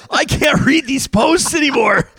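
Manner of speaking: a hammed-up wail of being emotionally wrecked; overwhelmed outcry. Host overwhelmed by a sad pet post, crying out high and loud. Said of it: "And I was like".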